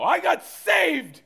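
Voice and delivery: shouted way up high, slow at first; hammed-up fervent shout at the family; mock zealous yell. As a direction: blurt it like an overeager new convert. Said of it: "It's usually not the best approach".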